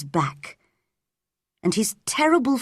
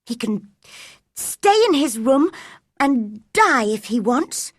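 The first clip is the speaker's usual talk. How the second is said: put-on higher, huffy young girl; cross thought; 6 semitones higher than usual. A narrator's reading. Mary sulking in her own room, cross. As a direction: think it crossly, pitched up. Angry girl thinks about sick boy.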